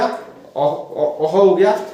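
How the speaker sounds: स्पष्ट विसर्ग के साथ अः बोलना; visarga sound demo. Shown as a demonstration. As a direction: breathe a light h after अ. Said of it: "पीछे हॉ की ध्वनि हल्की सी आ रही है".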